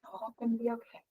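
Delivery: very soft, hushed self-reassurance; little inner voice. Retold or imitated. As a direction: act out a tiny voice soothing you. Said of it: "this other little voice is like".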